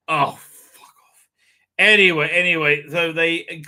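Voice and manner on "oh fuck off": slow, fed-up dismissal; exasperated. Real, not acted. Dismissing the title sequence, slow and fed up.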